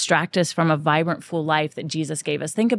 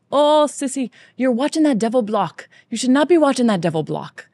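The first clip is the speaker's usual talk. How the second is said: higher-pitched, badly faked Irish accent; horrible Irish accent; 6 semitones higher, 3 dB louder than usual. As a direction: scold in a hammed-up, amateurish Irish accent. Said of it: "that's my horrible Irish accent".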